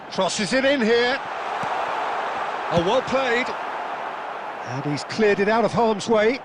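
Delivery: lively live play-by-play, rising with the action; fast sports commentary. Acted. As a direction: call the match as it unfolds.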